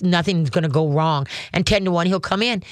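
ordinary talk

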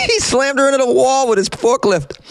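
can't believe how great this is; delighted. Real, not acted. Host gushing to viewers about the crash, gleeful.

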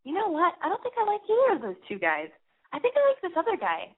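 imitated girlfriend. Girlfriend announces which guy she likes, imitated in a high voice. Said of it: "my girlfriend comes over to me and she goes".

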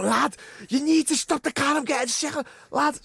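loud, high-pitched retold panic; urgent mock plea. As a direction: imitate a queasy mate yelling for the car to stop. Retold carsick mate begging to stop.

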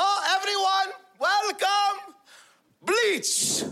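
high, mock-grand self-introduction; sarcastic announcement. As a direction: announce yourself with high-pitched, bitter fanfare. Mother announces herself by mocking nickname.

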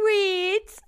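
put-on high, loud little-kid "Hi"; tiny child greeting the family on camera, high and loud; toddler voice. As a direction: squeak it like a two-year-old. Toddler answering.

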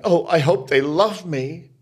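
high, loud, whiny mock plea; mocking a performer who craves the audience's love; mock needy performer.

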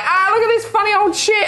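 high, laughing jeer; mocking teens. Teenagers laughing at the actor, high. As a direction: jeer at him. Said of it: "they're like".

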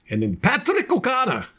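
put-on jokey high Irish accent. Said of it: "that was my Irish accent".

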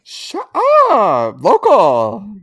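excited surprise. Real, not acted. Excited at local drink news.

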